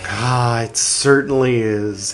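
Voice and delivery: ordinary talk, nothing marked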